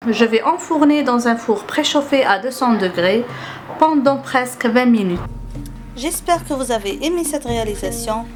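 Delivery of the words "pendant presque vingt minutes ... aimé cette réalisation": ordinary talk, nothing marked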